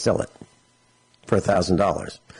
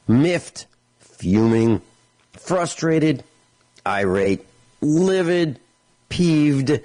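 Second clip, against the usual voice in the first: each anger word tossed off higher, a half-mock rant; hammed-up list of anger words; 5 semitones higher, slower than usual. Real, not acted. Radio host telling listeners how he feels, mock-angry listing. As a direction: pile up the synonyms with mock heat, pitch jumping up. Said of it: "I'm angry".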